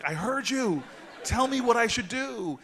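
high, loud, exasperated outburst; exasperated mock yell. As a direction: yell it comically, at the end of your patience. Retold exasperated outburst at the woman.

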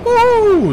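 a sudden high shriek of fright; startled shriek. Real, not acted. Gamer jumping into the silo, startled high shriek. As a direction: yelp as the ground drops away.

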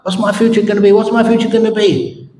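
can barely wait for the answer; an overexcited man begging to hear his future; eager excitement. Retold or imitated. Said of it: "the man couldn't stop himself".

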